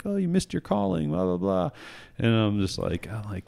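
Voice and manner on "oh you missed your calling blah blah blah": gushing praise. Retold or imitated. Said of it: "They're like".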